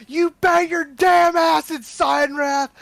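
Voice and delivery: high, slow roaring war cry; furious battle shout. Acted. Warrior ambushing raiders from the bush, furious shout. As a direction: bellow it like a drill sergeant bursting from cover.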